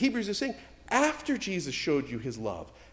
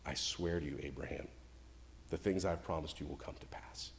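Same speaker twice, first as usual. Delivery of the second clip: much lower and softer, calm and certain; voice of God; 11 semitones lower, 13 dB quieter than usual. Retold or imitated. God reassuring Abraham of his love, low and calm. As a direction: speak as God, low and unhurried. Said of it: "that's what God was doing".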